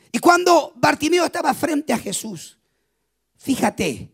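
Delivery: ordinary talk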